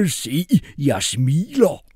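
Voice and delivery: very slow, far-off voice; slow forced cheer; stiff old mercenary. A narrator's reading. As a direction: drag each word out deadpan. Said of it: "stod midt på gulvet og råbte".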